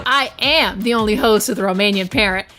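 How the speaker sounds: ordinary talk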